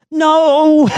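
put-on high, loud child's no; a scared kid flatly refusing; high yelped refusal; frightened little boy.

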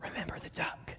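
menacing unvoiced whisper. Sister whispering a threat to her brother as she passes. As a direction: hiss it under your breath as a threat. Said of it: "walks by Johnny and said".

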